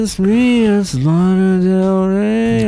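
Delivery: high, slow sleepy pop-star voice; [name] impression. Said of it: "I didn't know [name] was gonna show up".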